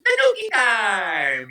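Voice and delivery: high, drawn-out playful call; gleeful catchphrase. Acted. Game actor greeting the falling leaf, high gleeful cry. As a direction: sing out the words with loud glee. Acting out delight at power-up.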